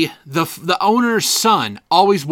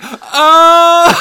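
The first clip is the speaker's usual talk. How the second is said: loud, high, drawn-out "oh"; imitated class groan; 11 semitones higher, 9 dB louder than usual. Classmates finally getting the joke, in a loud wave of oh. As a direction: mimic a whole class reacting at once. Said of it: "just a wave of".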